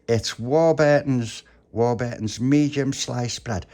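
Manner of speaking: slow, drawn-out proud reveal; loud, lingering boast; savouring announcement. Acted. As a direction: stretch out the brand name with relish. Tony boasts about the treat.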